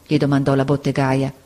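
plain reading voice

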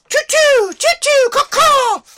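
loud high bird calls; bird imitation. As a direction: squawk like a cacophony of birds. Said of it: "the giant cacophony of".

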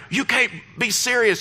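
high-pitched retold amazement; mildly acted thrilled disbelief; overjoyed disbelief. Gary to stewardess about first class, overjoyed disbelief. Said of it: "he's almost in tears".

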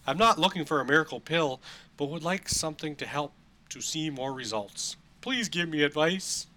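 high, loud, emphatic read-aloud; overplayed letter-reading voice; dramatic email reading. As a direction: read the listener's plea with hammed-up emphasis. Reads listener email aloud.

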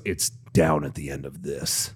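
a parking guy leading a driver to a special spot, low and hushed; low, quiet attendant voice. Retold or imitated. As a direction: drop the voice low and hushed like a shady guide.

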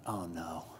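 very low, hushed dismay; retold sinking dread. Dad as son sprints over and clings, low retold dread. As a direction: drop it right down, as if to yourself. Dreading son's second breakdown.

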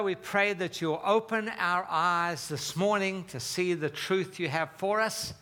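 ordinary talk

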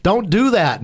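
high, urgent warning; captain's alarmed high command; urgent correction. Retold or imitated. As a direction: stop him turning into the boat.

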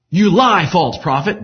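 high, loud accusing cry. A narrator's reading. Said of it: "William cried".

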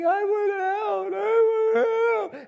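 put-on very high, slow wailing little child; crying toddler. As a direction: whine it like a trapped toddler. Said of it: "he was just going".

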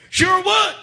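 high, loud retold reply; eager yell. Woman accepting the Holy Ghost offer, eager yell. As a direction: burst out with an eager yes.

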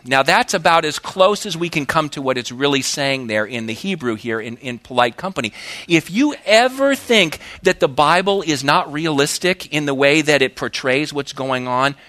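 usual speaking voice

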